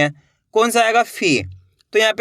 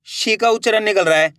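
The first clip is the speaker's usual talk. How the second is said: ordinary delivery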